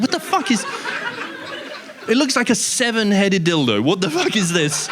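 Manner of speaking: high, shocked and laughing; what on earth is that; shocked disbelief. Retold or imitated. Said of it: "And you're like".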